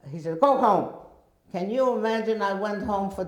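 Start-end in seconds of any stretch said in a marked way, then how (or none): 0.4-0.9 loud, high curt command; stern order. Retold or imitated. Principal ordering the girl home, stern and loud. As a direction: send her away sharply.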